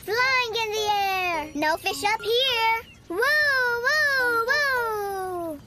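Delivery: excited flying. Pretend. Duck flying in play.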